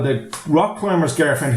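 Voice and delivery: usual speaking voice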